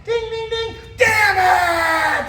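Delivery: high chimed ding, then loud mock yell; imitating the alarm and her angry shout; alarm then curse. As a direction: ring the alarm and explode. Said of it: "same thing".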